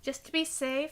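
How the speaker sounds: normal talking voice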